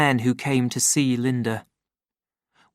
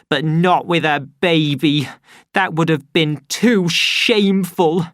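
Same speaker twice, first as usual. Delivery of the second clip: loud, high, bitter yelling; angry shout; 6 semitones higher, 5 dB louder than usual. Acted. Linda shouts at her son in bitter rage. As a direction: hurl it at him in rage. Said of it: "she shouted".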